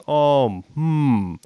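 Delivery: drawn-out thinking sounds, imitated; "Oh", then a pause and a pondering "hmm"; pausing mannerism. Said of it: "like the pausing and going".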